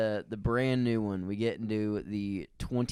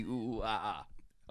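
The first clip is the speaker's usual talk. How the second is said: monkey noises; 2 semitones lower, 7 dB quieter than usual. Pretend.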